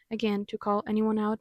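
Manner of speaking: ordinary delivery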